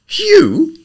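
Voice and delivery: high, loud stunned yelp; gaping surprise. A narrator's reading. Freddie staring at Eve, gaping in surprise. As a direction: caught completely off guard.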